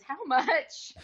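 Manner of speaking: high, loud blurt before a laugh; mock eager question. Actress retelling her question about pay, comically blurted. Retelling reaction to nude role offer.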